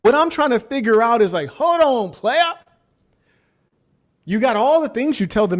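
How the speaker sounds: mock-outraged questioning; incredulous rant. Real, not acted. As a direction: call him out in disbelief.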